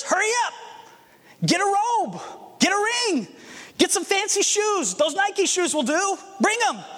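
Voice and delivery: father barking happy orders to the servants; excited orders. Acted. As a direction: hurry the servants like a thrilled dad.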